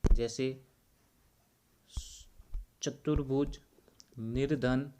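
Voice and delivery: ordinary delivery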